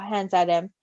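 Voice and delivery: ordinary delivery, nothing marked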